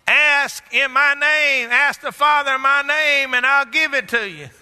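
high, ringing scripture proclamation; full-force preaching. Real, not acted. Preacher quoting Jesus' promise to congregation, full-force preaching. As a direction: proclaim the promise to the whole church.

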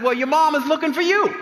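high, mocking echo of the warning; mocking repeat. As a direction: toss the warning back with a sneer. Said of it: "I, I said".

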